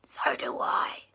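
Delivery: gasping frightened whisper; scared whisper. Acted. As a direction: whisper a warning to auntie. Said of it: "Christopher went".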